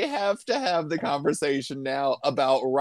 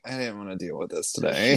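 bored mutter; 10 semitones lower, 5 dB quieter, faster than usual. Retold or imitated. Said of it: "She's just like".

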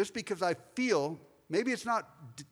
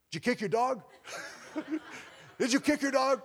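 mock teasing churchgoers; 6 semitones higher than usual. Church members teasing him weekly.

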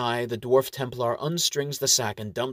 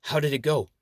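slightly higher, eager question; 4 semitones higher than usual. A narrator's reading. Franklin asks Ariel about the plan, eager. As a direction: ask with keen interest. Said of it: "asks her eagerly".

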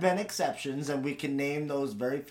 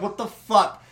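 outraged surprise; 6 semitones higher, 9 dB louder than usual. Retold or imitated. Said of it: "You'll be like".

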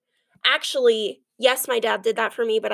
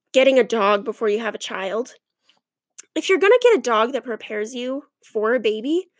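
normal talking voice

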